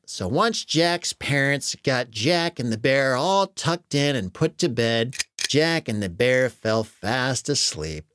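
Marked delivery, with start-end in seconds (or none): none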